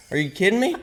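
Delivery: high-pitched incredulous question; retold disbelief. Speaker reacting to his overheard swearing, incredulous.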